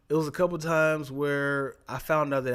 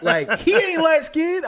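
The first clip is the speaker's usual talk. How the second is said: laughing; 14 semitones higher, 10 dB louder than usual. Retold or imitated. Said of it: "I was literally like".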